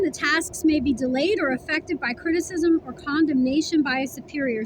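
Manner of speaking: normal talking voice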